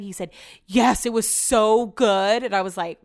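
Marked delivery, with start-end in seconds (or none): 0.7-2.4 loud, drawn-out rave; imitating an emphatic, delighted yes; enthusiastic answer. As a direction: gush it with slow, heavy emphasis. Quoting partner's keen answer.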